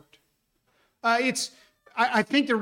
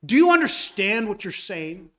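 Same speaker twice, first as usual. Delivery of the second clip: raised, higher indignant rebuke; Paul's rebuke; 4 semitones higher, 6 dB louder than usual. Retold or imitated. Paul rebuking the man who calls God unjust, indignant. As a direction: scold the questioner in disbelief. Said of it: "It's as if he is saying".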